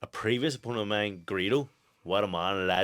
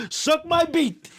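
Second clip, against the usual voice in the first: put-on high, slow, loud French-accented yell; mock French accent; 6 dB louder, much slower than usual. Boxer imitating the wee French fighter's yell in a mock French accent. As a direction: ham up the French guy's broken English. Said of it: "the wee French guy".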